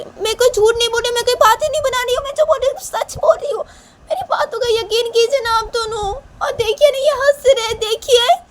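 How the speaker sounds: very high, loud, whiny plea; desperate protesting girl. Acted. Shanaya begs parents to believe her, desperate. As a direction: beg tearfully to be believed.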